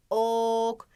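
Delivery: "Ok" with a long, even o; stretched initial O. Shown as a demonstration. Teacher stressing the first sound, drawn-out o in "Ok". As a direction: draw out the o of "Ok". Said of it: "Yine başında çok fazla vurguluyorum".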